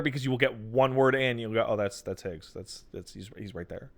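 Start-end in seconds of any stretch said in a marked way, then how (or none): 1.7-3.9 very soft, low mumbled aside; muted realization. Retold or imitated. Player quietly spotting a familiar actor, muted and unimpressed. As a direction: say it low, almost under your breath.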